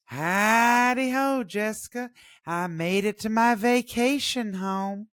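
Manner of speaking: high, slow creepy jester; sing-song creepy clown greeting. Retold or imitated. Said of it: "using the Harlequin voice".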